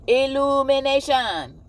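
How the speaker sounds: ILLUMINATION called out high, loud and drawn out; big stretched-out announcement of "Illumination"; loud proclamation. Real, not acted. Announces the word, then repeats it.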